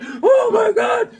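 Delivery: high, drawn-out shriek of panic; a shrieking, stretched-out oh my god; panicked scream. Retold or imitated. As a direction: scream it like you're going under. Panicked in imagined sinking submarine.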